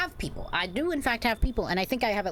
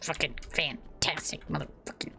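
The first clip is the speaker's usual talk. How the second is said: whispered curse; 2 semitones lower, 4 dB quieter than usual. Real, not acted. Cursing under breath at game.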